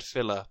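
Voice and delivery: "Phyla" as FILL-uh; short i in "Phyla", like "filler"; pronounced fill-a. Shown as a demonstration. British co-host giving his version, "Phyla" said fill-a. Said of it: "I've always said".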